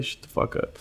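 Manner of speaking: unvoiced whispered jab under the breath; whispered aside. Telling off the cranky patient in a whisper. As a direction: mutter it so the patient can't hear.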